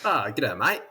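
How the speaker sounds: hammed-up Aussie greeting with broad vowels; terrible Australian accent. Guest answers host's "How are you, mate?", bad Australian accent. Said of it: "That's a terrible Australian accent".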